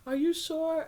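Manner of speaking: high-pitched imitation of a doubter; doubtful questioning. Husband asking while they get ready, doubtful. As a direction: mimic him doubting, voice lifted high. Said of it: "he keeps telling me".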